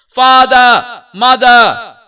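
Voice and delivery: high, very loud shriek; shrieking cry. A narrator's reading. Child trampled in the crowd shrieks for his parents. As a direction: scream it at the top of the voice. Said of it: "shrieked at the highest pitch of his voice".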